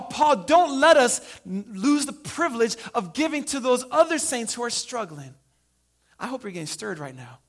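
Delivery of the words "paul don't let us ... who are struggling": impassioned begging. Retold or imitated. Poor church pleading with Paul to let them give, impassioned. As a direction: plead with Paul as if it's urgent.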